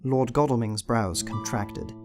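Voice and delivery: ordinary talk, nothing marked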